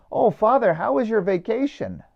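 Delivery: lightly mock a friendly, innocent question; chirpy parishioner. Parishioner greeting the priest back from pilgrimage, chirpy.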